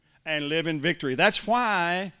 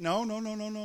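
ordinary talk

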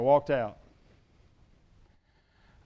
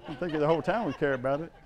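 usual speaking voice

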